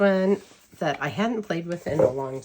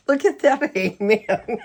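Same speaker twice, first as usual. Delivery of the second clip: bursting into laughter, higher and louder; laughing; 7 semitones higher, 10 dB louder than usual. Real, not acted. Vlogger cracking up at a cute hedgehog tarot card, laughing. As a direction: crack up over the silly card. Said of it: "this deck cracks me up".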